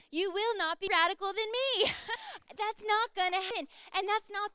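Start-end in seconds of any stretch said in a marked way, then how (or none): 0.1-1.9 high, loud teasing boast; playful challenge. Retold or imitated. Preacher teases young people, loud playful boast. 1.9-2.5 quick high burst of laughter. Real, not acted. Preacher laughs at her teasing boast, high laugh.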